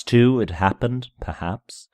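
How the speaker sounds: plain reading voice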